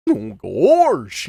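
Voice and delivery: swooping cartoon "gawrsh"; Goofy. Shown as a demonstration. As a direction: let the word leap up and tumble down, bumpkin style. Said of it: "he added a lot of".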